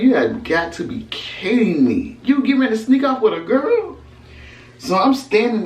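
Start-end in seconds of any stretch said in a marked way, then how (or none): 0.0-4.0 disbelief. Retold or imitated. Catching him with a girl.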